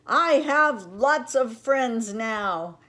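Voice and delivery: "I have lots of friends now" shouted loud and slow; very loudly. A narrator's reading. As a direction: shout it across the pond with joy. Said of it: "he said it again very loudly".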